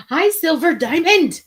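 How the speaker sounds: ordinary delivery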